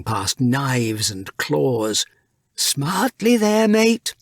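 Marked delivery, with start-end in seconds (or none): 2.6-4.2 higher, urging call to hurry along; captain calling out. A narrator's reading. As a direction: hurry your mate along as you duck. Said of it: "Blacktail called out".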